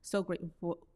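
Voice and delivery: ordinary talk, nothing marked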